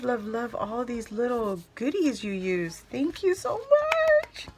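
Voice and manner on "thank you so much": very high, slow, loud, near-tearful cry; gushing thanks. Real, not acted. Thanking Candace for her card, a high tearful cry. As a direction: wail your thanks with joy.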